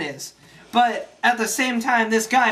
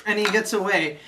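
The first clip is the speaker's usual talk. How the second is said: usual speaking voice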